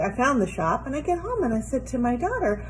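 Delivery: ordinary talk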